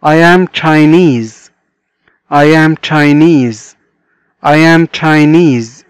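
very slow, each word stretched apart; very slow model reading. Shown as a demonstration. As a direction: dictate it slowly for beginners. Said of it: "teacher modelling sentence".

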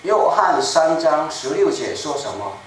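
ordinary talk

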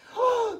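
high, startled gasp; quick dramatic intake of breath; sharp gasp. Acted. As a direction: gasp in sudden fright. Wolf leaps at the girl.

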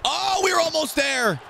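high-pitched frustrated yell; so close, wailed high; anguished cry. Real, not acted. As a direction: cry out at a near miss. Interception just missed in game.

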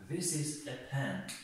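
very slow, every word spaced out; slow dictation. Shown as a demonstration. Teacher dictates to students, very slow. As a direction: spell it out slowly for the class.

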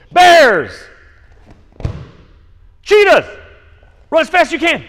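high, loud game call; called-out command. Real, not acted. Coach calling out jungle game cues to kids, loud high shout. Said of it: "I'm gonna call out".

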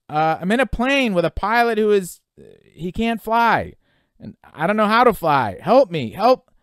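loud, high, stammering cry for help; frightened, halting yell rising at the end; panicked plea. Acted. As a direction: shout desperately into the radio. Panicked plea over the radio.

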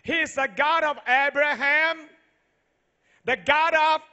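loud, high preaching cry building to the names; preaching at full force. Acted. Pastor booming to the congregation, full-force preaching. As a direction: proclaim it to the whole hall.

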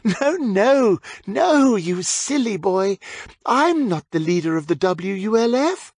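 a hint of a witch talking down to a silly boy; patronising witch. A narrator's reading.

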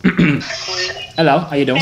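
greeting in a fake British accent; lightly faked UK accent, friendly hello; put-on British accent. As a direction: pretend Brit saying hello. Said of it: "Was my accent that good?".

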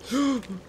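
quick, high gasp; frightened gasp. Real, not acted. Player as enemy closes in, frightened gasp. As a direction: suck in air in alarm.

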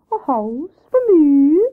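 high, drawn-out owl question; Wise Owl echoing. Acted. Owl echoes news of his house, slow hooting question. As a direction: hoot the question slowly, rising at the end. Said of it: "echoed Wise Owl".

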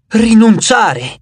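drawn-out, anguished repeat of the word; pained disbelief. A narrator's reading. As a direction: stretch the word out, hurt and stunned. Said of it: "ripeté lui addolorato".

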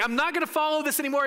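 high, fast, exasperated outburst; acted giving up. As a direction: play someone fed up and quitting. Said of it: "he decided to give it up".

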